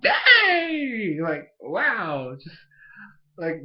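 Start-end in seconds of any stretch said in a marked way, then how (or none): 0.0-1.2 high, loud yell of astonishment; amazed shout. Retold or imitated. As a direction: blurt it out, blown away. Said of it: "And I'm like".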